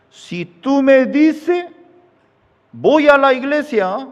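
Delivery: high, loud, slow threat; threatening husband. Acted. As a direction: lay down the threat slowly and loudly. Husband threatens his wife.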